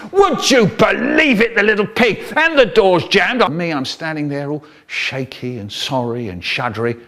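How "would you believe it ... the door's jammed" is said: loud, high-pitched outraged roar; roaring dad. Retold or imitated. Dad raging about the little pig, acted furious roar. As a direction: bellow it like a furious father. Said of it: "My dad, and he's roaring".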